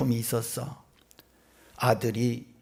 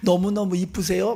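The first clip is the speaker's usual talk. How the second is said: higher-pitched, smiling compliment; cheerful gushing; 5 semitones higher than usual. Retold or imitated. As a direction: imitate a friendly boy's eager praise. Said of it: "밝게 웃으면서 인사를".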